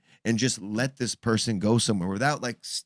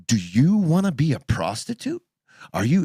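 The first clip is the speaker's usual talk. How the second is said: loud, raised-pitch angry challenge; pissed off; 6 semitones higher, 6 dB louder than usual. Retold or imitated. Parent retelling confronting her over OnlyFans, loud angry challenge. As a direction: confront them with an outraged question. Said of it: "I got so pissed".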